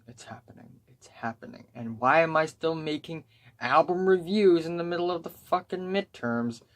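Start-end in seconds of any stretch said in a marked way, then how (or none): 0.1-1.7 hushed ominous whisper. Pretend. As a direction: breathe it out like a dark omen. Dread about midterms.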